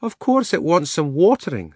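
high-pitched, assured lady elephant. A narrator's reading. She-elephant answering the donkey, high and matter-of-course. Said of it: "said the elephant".

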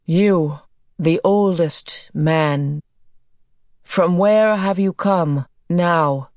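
slow, measured interrogation; deliberate stern questioning. A narrator's reading. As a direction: demand it sternly, word by word. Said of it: "speaking sternly".

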